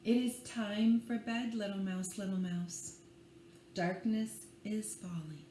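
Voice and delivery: put-on gentle read-aloud for small children; bedtime story reading. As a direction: lull them along with the rhyme. Reads picture book aloud.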